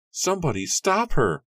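high, loud call for help; alarmed shout. A narrator's reading. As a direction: yell at the crowd to stop her. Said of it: "Alarmed voices rang out from the crowd".

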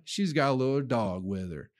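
usual speaking voice

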